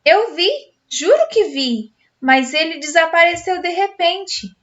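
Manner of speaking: put-on girl insisting to her parents she saw a ghost; agitated. A narrator's reading. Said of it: "digo na maior agitação".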